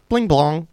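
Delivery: high bright bling blong; chiming computer noise. Retold or imitated. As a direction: sing the chime up high. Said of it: "I think it goes".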